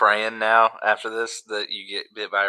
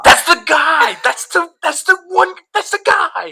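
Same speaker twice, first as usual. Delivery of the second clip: excited squeal; 7 dB louder than usual. Real, not acted. Recognizing the SpongeBob guy.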